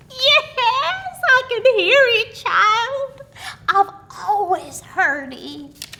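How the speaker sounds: put-on high, gleeful old woman; merry old aunt. A narrator's reading. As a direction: crow it out with pent-up glee. Old aunt answers merrily.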